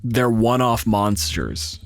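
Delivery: ordinary talk, nothing marked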